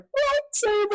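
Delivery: very high-pitched questioning squeal; shocked disbelief. Retold or imitated. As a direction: squeak it out as a stunned reaction. Reenacting shocked reaction to game twist.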